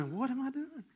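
high, hushed self-questioning; bewildered inner thought. Retold or imitated. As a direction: mimic a puzzled thought under your breath. Voices own bewildered thought while kneeling.

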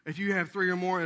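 ordinary talk

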